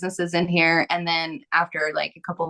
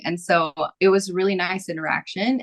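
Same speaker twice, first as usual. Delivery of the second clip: ordinary talk, nothing marked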